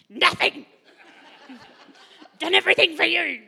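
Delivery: high, loud, fast mock snap; hammed-up exasperated mum; retold snap at son. Mum retelling how she snapped at her son, mock angry. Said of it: "I just said".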